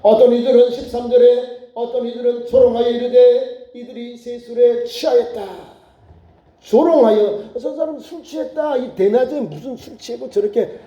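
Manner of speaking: normal talking voice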